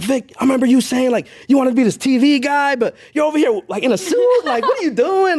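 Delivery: high, incredulous pep talk to himself; retold self-scolding. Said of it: "I was talking to myself".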